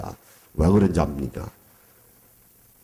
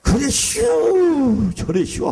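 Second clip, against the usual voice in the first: Chungcheong drawl; slower than usual. Retold or imitated. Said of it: "이 충청도 사람은".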